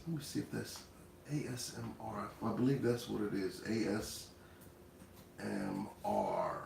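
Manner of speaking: very soft, absent-minded checking aloud; talking to self. Real, not acted. Host checking a term for himself, very soft. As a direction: say it low, as if looking something up.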